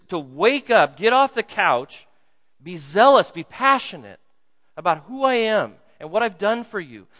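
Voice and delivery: loud, urgent plea; passionate pleading. Real, not acted. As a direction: beg them with full force. Impassioned plea to congregation.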